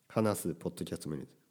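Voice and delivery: normal talking voice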